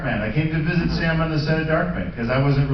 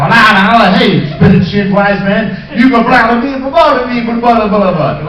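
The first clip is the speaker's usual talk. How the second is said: louder and higher, slow start then rattling blah blah; mock director rant; 6 semitones higher, 9 dB louder than usual. Director piling dialogue on his actor, loud. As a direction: rattle off endless new lines. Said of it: "And he goes".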